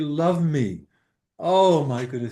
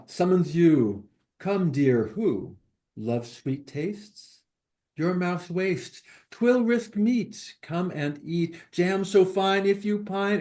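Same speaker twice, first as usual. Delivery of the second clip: slow, emphatic verse reading; deliberate, lilting poetry reading; poem recitation; 3 semitones higher than usual. Acted. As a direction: recite each short rhymed line with weight.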